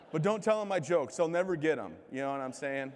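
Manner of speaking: normal talking voice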